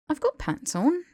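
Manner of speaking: sheepish protest; weak protest. A narrator's reading. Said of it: "Harry protested weakly".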